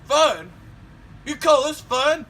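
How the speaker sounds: put-on loud high disbelieving old man; incredulous old man. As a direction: shout it in disbelief. Dad disbelieves son.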